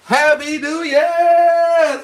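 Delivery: high, loud, slow cheer; joyful shout. Real, not acted. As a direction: shout it at midnight. Midnight celebration cheer.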